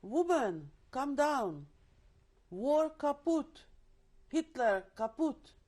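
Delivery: loud, high calls with a slow War kaput; hollering. Retold or imitated. Forced laborer hollering up to the hidden woman. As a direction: holler it up into the barn loft. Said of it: "started hollering".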